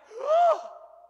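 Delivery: a sharp, high hammed-up gasp; theatrical gasp of shock; loud mock gasp. Reacting to wise men bowing.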